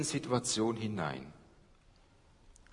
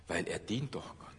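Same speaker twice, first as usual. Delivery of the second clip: whisper; 3 semitones lower, 7 dB quieter than usual. Acted. Whispered aside about Paulus.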